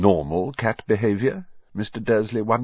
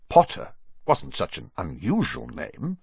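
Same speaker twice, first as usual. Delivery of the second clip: plain reading voice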